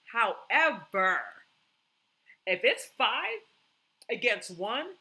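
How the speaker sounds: slow, loud, drawn-out HOWEVER; emphatic. Real, not acted. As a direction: lean hard on each word, slowly. Stressing her key point.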